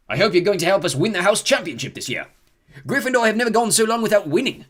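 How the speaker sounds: rally the new students; rallying ghost. A narrator's reading. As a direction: ghost urging new Gryffindors. Ghost rallies the house.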